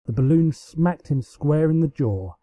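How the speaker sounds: usual speaking voice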